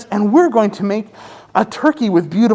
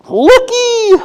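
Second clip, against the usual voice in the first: high hooting call, much higher; hooting turkey. Acted. Brother turkey hooting at the others, high hoot. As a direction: hoot it like a bird. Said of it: "hoots a brother turkey".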